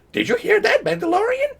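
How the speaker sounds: very high squeaky mock Yoda reading. Mocking Google's tweet, squeaky Yoda voice.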